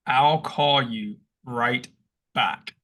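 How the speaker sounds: very slow, each word apart; breaking it apart. Shown as a demonstration. Taking the phrase apart for his friend, very slow. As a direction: spell out the phrase, pausing before back. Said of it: "break that sentence apart".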